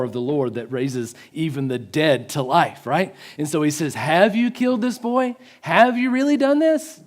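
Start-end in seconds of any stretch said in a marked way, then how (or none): 3.9-7.0 high-pitched, insistent questioning; doubting question. Retold or imitated. Elijah asks God about the boy, doubting and pleading. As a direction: press the question upward to God. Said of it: "doubt and question in his tone".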